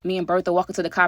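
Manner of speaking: ordinary talk, nothing marked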